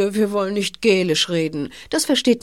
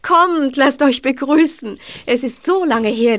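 joyful welcome; 6 semitones higher, 3 dB louder than usual. Acted. Cousin greets visitors.

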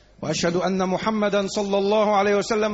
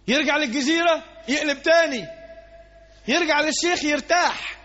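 higher, louder sermon shout; preaching at full force; 8 semitones higher, 4 dB louder than usual. Acted. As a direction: thunder it out to the congregation. Preacher raises voice. (الواعظ يخاطب المصلين بصوت خطابي عالٍ)

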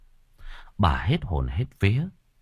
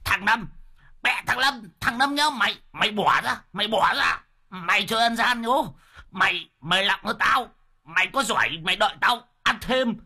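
much higher, squealing yell; shrieking old man; 11 semitones higher than usual. A narrator's reading. Pinned old man yelling at Lâm Sẹo, much higher shriek. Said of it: "cái miệng của lão vẫn cứ gào lên choe choé".

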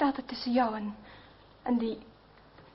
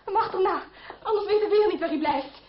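urgent; 5 semitones higher, 5 dB louder than usual. Acted. Urgent hurry after André.

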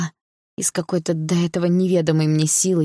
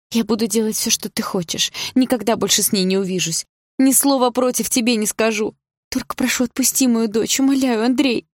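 higher, desperate begging; pleading; 7 semitones higher than usual. A narrator's reading. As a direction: beg him, voice rising. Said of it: "взмолилась мама".

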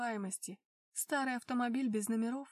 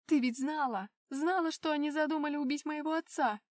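loud accusing cry; angry shout; 4 semitones higher, 6 dB louder than usual. Acted. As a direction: hurl the accusation at her. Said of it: "выкрикнула я". (гневный крик)

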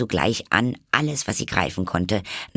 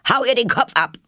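high, loud shrieking order; furious command; 6 dB louder, faster than usual. A narrator's reading. Duchess shrieking at the cook to behead Alice.

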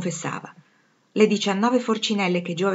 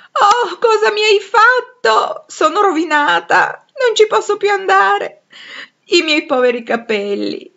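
high, loud, drawn-out wail of ruin; hammed-up, high-pitched moan of dismay; moaning lament; 14 semitones higher, 9 dB louder, slower than usual. A narrator's reading. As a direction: wail it slowly in theatrical despair. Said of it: "gemeva Meg".

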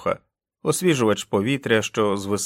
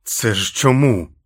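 louder, slow challenging question; angry demand; 6 dB louder, slower than usual. Acted. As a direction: demand the reason angrily. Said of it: "сердито дивився". (сердите повільне запитання)

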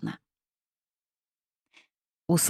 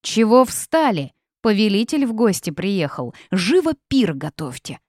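loud, higher-pitched bossy bark; 4 semitones higher, 5 dB louder than usual. A narrator's reading. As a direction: bark orders at idle servants. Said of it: "рявкнула так, что я подпрыгнула".

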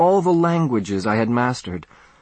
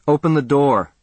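a firm, louder command; demanding; 4 semitones higher, 4 dB louder than usual. Acted. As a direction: order her through the door. Demanding at the locked door.

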